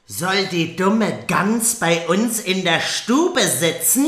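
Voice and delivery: loud, slow jeering stepsister; mocking stepsister. Stepsisters jeering at Cinderella, loud and slow. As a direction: sneer it out at the poor girl, loudly.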